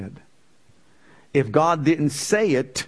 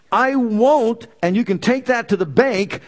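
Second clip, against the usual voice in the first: loud, higher I won't; emphatic proclamation; 7 semitones higher, 3 dB louder than usual. Acted. Preacher declaring God's vow to congregation. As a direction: proclaim it like a promise.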